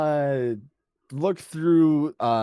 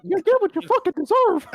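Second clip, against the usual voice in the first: high squeaky cartoon mouse; Mickey Mouse voice; 5 dB louder than usual. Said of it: "you hear Mickey Mouse going".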